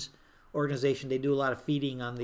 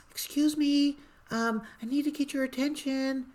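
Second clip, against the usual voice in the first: little voice; 10 semitones higher than usual. Retold or imitated. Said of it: "in this little voice".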